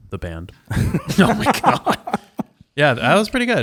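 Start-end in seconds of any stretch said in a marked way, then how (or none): 0.7-3.6 laughing. Real, not acted. Laughing after the bit.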